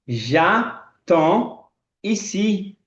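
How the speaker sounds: very slow, stretched French; very slow demo. Shown as a demonstration. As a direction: stretch every syllable for learners. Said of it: "Eu vou falar". (francês esticado, bem lento)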